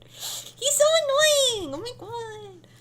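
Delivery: very high, drawn-out whine; exasperated squeal. Real, not acted. Player fed up with the teasing character, exasperated squeal. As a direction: wail it high and slow, then let the volume drop.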